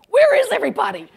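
high, loud, fast cry; exasperated high-pitched yell; blunt outburst. Retold or imitated. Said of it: "That's the polite way of saying".

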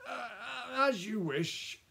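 reluctant assent. Acted. Lawyer yields reluctantly to Madame.

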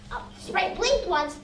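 ordinary delivery, nothing marked

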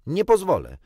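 loud, high-pitched refusal; shouted. A narrator's reading. Michael in his basket refusing Świstek, shouted. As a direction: cry out in refusal. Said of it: "krzyknął Michael".

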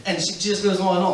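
usual speaking voice

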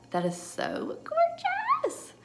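high, slow swooning gush. Real, not acted. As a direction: swoon over the color, drawn way up. Gushing over the blush shade.

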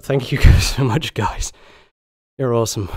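loud, laughing thank you; thrilled, grateful burst; excited thanks. Real, not acted. Grateful shout for donations.

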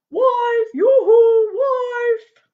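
high, loud sing-out holler; calling out. Acted. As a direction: holler for someone far away, drawing out the words. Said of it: "he called his wife".

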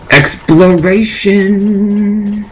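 high, slow stretched exploration; playful stretched word; drawn-out word. Real, not acted.

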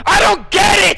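high, loud baffled outburst; exasperated cry. Real, not acted. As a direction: throw up your hands and yell it. Exasperated reaction to chat message.

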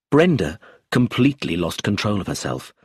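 ordinary delivery, nothing marked

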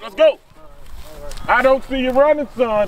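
loud, high coach bark across the field; coach shouting. Acted. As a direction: hustle them up like a coach. Coach yelling at players.

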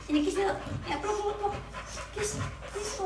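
high, soft cooing for a kiss. Real, not acted. Mom making up with her dog, soft high coo. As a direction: coax the dog into a cuddle. Cuddling dog after scolding it.

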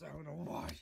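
ordinary talk

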